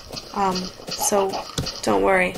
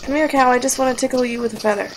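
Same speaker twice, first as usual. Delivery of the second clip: usual speaking voice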